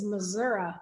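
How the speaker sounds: "Missouri" firmly ending on "uh", not "ee". Retold or imitated. Host retelling being corrected, "Missouri" ending in "uh". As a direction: say "Missouri" as "muh-ZUR-uh". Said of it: "I was told".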